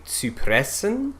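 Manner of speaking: "Zypressen" with a rounded Ü; German pronunciation. Shown as a demonstration. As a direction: say "Zypressen" with a pure Ü. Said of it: "the Y in German is Ü".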